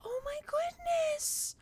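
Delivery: drawn-out squeal of delight; high slow coo. Real, not acted. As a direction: melt over a tiny pet, slowly and high. Cooing over a hamster.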